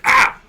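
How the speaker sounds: startled yelp. Retold or imitated. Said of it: "He's just like".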